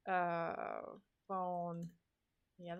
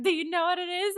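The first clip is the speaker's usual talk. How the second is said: mocking smug voice; 9 semitones higher than usual. Mimicking a smug Gen Zer holding a floppy disk, mock smug. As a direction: sneer like a know-it-all student. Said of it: "so smug".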